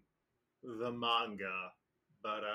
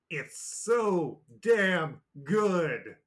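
excited gushing; 7 semitones higher, 11 dB louder, much slower than usual. Real, not acted. Fan gushing over the episode.

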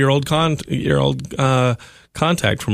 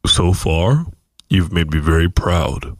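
God voice; 3 semitones lower than usual. Pretend. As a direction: pass judgment like a stern God. God speaking to Zach.

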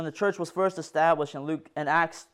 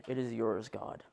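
much softer, fading to a breath; hushed prayer; 7 semitones lower, 11 dB quieter than usual. Retold or imitated. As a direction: let it sink to a near whisper of surrender. Jesus surrendering in prayer.

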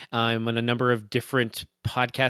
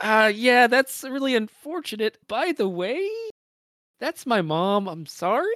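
high, strained, awkward apology; awkward forced apology; 11 semitones higher than usual. Retold or imitated. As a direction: squeeze it out high and uneasy. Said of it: "Kai's just".

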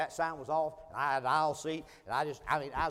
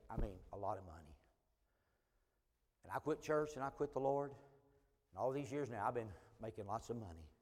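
hushed and much softer; confiding quietly; 6 semitones lower, 10 dB quieter than usual. Retold or imitated. Stranger confessing his past to the preacher, quiet and confiding. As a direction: confess it low and personal. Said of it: "his tone began to change".

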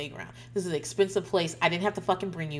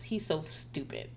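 muttered insult; 3 semitones lower, 9 dB quieter, slower than usual. Retold or imitated.

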